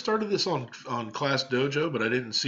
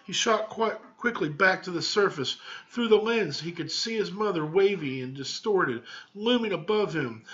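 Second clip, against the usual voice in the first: lively read-aloud storytelling; story narration; 4 semitones higher than usual. A narrator's reading. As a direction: read it to the class like a picture book.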